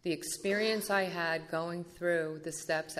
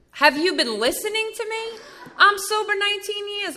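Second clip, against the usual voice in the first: high, loud, laughing disbelief; indignant laugh; 9 dB louder than usual. Retold or imitated. Snapping back at Val's big book question, laughing indignant and high. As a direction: scoff through a laugh, offended. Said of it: "And I'm like".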